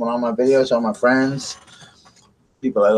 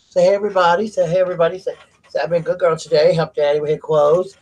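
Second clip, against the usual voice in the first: higher-pitched talk on behalf of the dog; playful pet voice; 6 semitones higher than usual. Pretend. Owner speaks for Waffles to greet everybody, playful higher voice. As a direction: speak up high as if for your pet.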